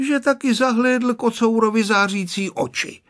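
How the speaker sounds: usual speaking voice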